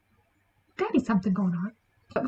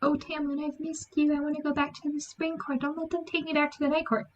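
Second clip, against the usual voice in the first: higher and softer, sugary fake longing; lightly hammed-up pleading to Tamlin; mock sweet pleading; 5 semitones higher, 7 dB quieter than usual. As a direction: coo it like a sister who is suddenly lovesick. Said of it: "She's like".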